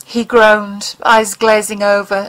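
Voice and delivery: ordinary delivery, nothing marked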